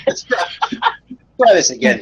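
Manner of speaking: laughing. Real, not acted. Guest restarting his introduction after the host's spam joke, laughing. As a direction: try to restart while still laughing.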